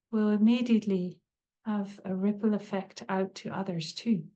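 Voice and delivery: calm, unhurried meditation voice with long pauses; guided meditation. Acted. Teacher leading listeners into a body scan, calm meditative guiding. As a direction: guide them gently, leaving space between phrases.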